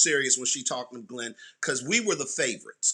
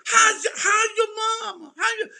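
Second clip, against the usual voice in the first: put-on high cackling old grandmother greeting, much higher and louder; imitating grandma; 5 dB louder, slower than usual. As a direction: squeal hey baby like a doting granny. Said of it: "she would literally be like".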